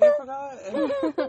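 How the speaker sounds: high, slow, laughing whine; mock forgetful whine. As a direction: drag it out, helpless and giggling. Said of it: "She's like".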